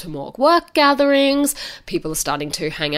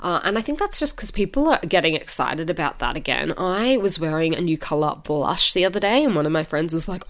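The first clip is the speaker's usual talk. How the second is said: ordinary talk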